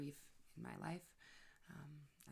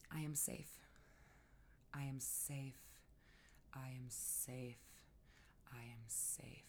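slow whisper fading softer each time; whispered mantra; 4 semitones lower, 10 dB quieter than usual. Shown as a demonstration. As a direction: breathe the mantra out to yourself. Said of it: "one of my most powerful mantras".

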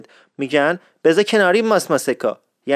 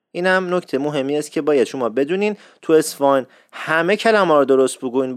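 ordinary talk, nothing marked

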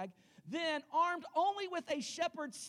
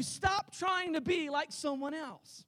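ordinary talk

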